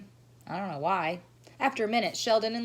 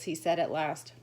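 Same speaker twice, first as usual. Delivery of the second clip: ordinary delivery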